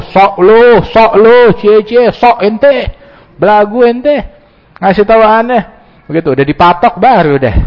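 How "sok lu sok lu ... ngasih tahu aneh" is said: high, sneering put-down; mock the one giving advice; scornful mocking retort. Man rejecting a friend's warning, sneering mocking retort.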